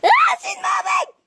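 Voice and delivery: high shouted alarm; startled shout. Real, not acted. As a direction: shout it in fright. Startled by cat.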